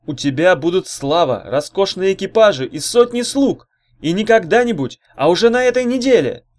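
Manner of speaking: loud, high huckster's pitch; fiery orator working the room; rousing rabble-rouser. Acted. Orator character promises riches to crowd.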